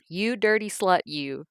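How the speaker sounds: angry insult. A narrator's reading. Mother cursing her daughter, loud and contemptuous. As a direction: hurl the insult.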